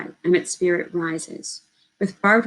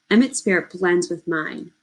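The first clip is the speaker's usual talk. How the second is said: plain reading voice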